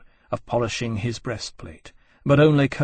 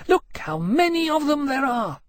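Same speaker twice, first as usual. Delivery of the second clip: very high, gloating dragon voice; exultant. A narrator's reading. She-dragon shows off her prizes, exultant. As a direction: crow over it, pitched way up. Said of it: "she added exultant".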